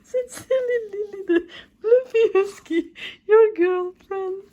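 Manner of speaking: very high, loud tearful voice; real crying over the letter's last words. Real, not acted. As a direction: sob out the sign-off. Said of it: "I'm like, I'm crying".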